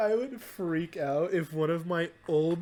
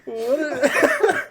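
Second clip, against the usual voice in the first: laughing; 11 semitones higher, 7 dB louder than usual. Real, not acted.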